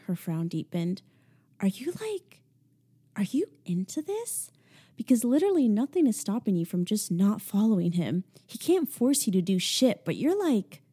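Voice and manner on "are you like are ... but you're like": higher, half-whispered disbelieving friend; Ashley, incredulous. Acted. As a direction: push her friend, stunned and pressing. Ashley confronting her friend.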